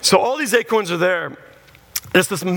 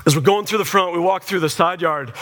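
ordinary talk

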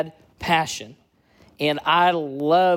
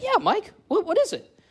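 much higher, curious and eager; eager reply; 14 semitones higher than usual. Retold or imitated. Young student answers his roommate Mike across the pizza table, eager and higher. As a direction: answer your buddy like you can't wait to hear.

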